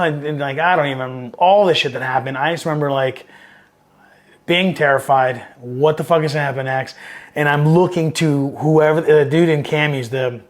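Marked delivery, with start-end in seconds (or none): none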